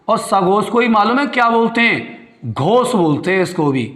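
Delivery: ordinary talk, nothing marked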